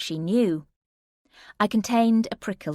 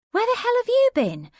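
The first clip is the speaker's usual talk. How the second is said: much higher, louder exasperated friend on the phone; Emma, angry friend; 5 dB louder than usual. Acted. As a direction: demand it, relieved and cross at once. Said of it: "It was Emma".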